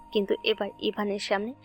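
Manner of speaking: normal talking voice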